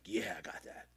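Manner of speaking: muttered whisper aside; unvoiced bored whisper. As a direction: mumble it under your breath. Bored reply to mentor quote.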